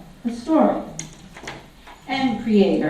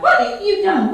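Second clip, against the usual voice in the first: high, frantic insect voice; tiny mosquito shrieking in alarm; buzzing mosquito; 13 semitones higher than usual. A narrator's reading. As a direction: squeak it out like an outraged little bug. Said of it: "Mosquito was all in a tizzy".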